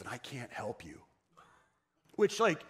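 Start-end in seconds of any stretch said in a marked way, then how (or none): 0.0-1.0 whispered, barely voiced; solemn hushed doctor's verdict; hushed grim news. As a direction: drop to a near whisper to break bad news.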